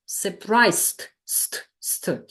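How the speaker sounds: SURPRISED ending in "st", then that sound alone. Shown as a demonstration. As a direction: isolate the final "st" of SURPRISED. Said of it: "Here it's st".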